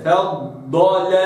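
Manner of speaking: فضل very slow, ض held long; held dad. Shown as a demonstration. Teacher demonstrating فضل again slowly. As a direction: stretch the ض in فضل. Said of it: "هكذا".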